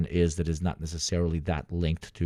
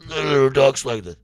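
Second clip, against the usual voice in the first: higher, louder put-on voice; hammed-up impression of the friend; imitating the guy; 6 semitones higher, 8 dB louder than usual. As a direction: snap back into the friend's voice.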